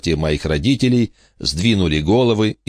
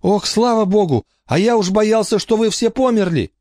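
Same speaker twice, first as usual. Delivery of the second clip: put-on much higher boyish voice, gushing relief; relieved boy on phone. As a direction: sigh it out like the fear just lifted.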